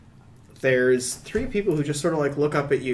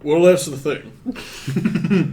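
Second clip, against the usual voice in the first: bartender voice; 3 semitones higher, 5 dB louder, much slower than usual. Acted. Bartender's slow answer.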